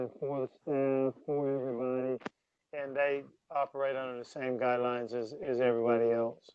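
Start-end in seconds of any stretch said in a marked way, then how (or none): none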